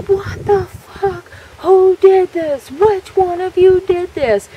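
slow, high, drawn-out furious holler; acted outraged yell. Charlie finding his burst beanbag, acted outraged yell. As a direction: imitate an enraged bellow, stretching every word. Voicing Charlie's enraged outburst.

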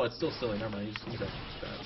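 usual speaking voice

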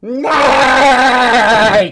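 loud neighing horse call; bouncy playful with animal noises. Pretend. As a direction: shout it like a playful pony. Playing furry in call.

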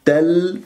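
"تل" with the final l held and flowing; still lam. Shown as a demonstration. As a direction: let the unvowelled l of "تل" sound briefly. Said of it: "هذه اللام ساكنة".

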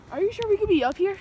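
ordinary talk, nothing marked

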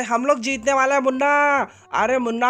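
ordinary delivery

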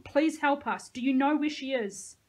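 earnest narrated plea; pleading. A narrator's reading. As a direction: beg urgently for help. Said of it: "Nico pleaded".

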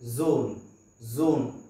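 ज़ोन starting with a voiced z; buzzing z. Shown as a demonstration. Teacher drilling ज़ोन, buzzing z. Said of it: "इसे हम पढ़ेंगे".

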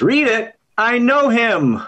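high-pitched, stretched-out exclamation; exclaimed punchline. Acted. Czech patient answering the optician in a joke, exclaiming. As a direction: land the joke's punchline high and drawn out.

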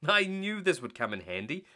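usual speaking voice

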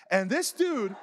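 normal talking voice

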